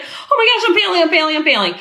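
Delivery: high-pitched mock panic, settling down; hammed-up fretting over failing; retold panic. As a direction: start high and flustered, tail off to normal. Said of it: "I was like".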